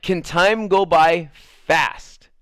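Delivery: slow, drawn-out impatient plea. Real, not acted. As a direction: stretch each word, wishing the wait over. Impatient at the long wait.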